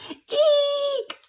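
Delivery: very high shriek; eek shriek. Acted. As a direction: shriek at the burning candy. Shriek at spicy candy.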